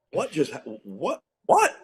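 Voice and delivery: stammered, softly started bewildered what; stunned disbelief. Retold or imitated. Coach left reeling after a rebuke walks off, stunned disbelief. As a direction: reenact being caught speechless, voice jumping up.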